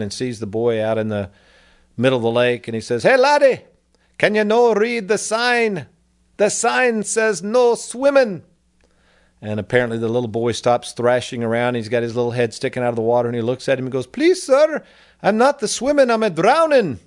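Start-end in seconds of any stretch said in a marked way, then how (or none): 3.0-8.4 put-on high, loud, slow Irish old man; hammed-up Irish brogue scolding a boy; Irish accent. Old man scolds swimming boy in Irish accent. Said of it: "I'll try to my best impersonation". 14.1-17.0 much higher Irish lad's voice; squeaky hammed-up Irish child; Irish boy accent. Drowning boy answers old man in Irish accent. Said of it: "I'll try to my best impersonation".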